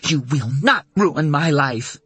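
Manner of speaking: each word a blow; furious defiance. Acted. Beating her attacker with cane.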